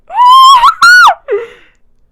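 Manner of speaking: loud, very high giddy scream-laugh; squealing shriek. Real, not acted. Retelling Andrew Garfield's line, loud giddy squeal. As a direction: squeal like a fangirl.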